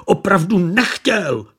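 the driver who caused the crash, crying out in panic; desperate cry. A narrator's reading. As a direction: cry out your innocence. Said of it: "vykřikl řidič Pabědy".

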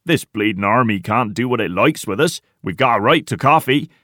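indignant argument. A narrator's reading. Tiny backs Porter on coffee rights, indignant. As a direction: argue hotly for your rights.

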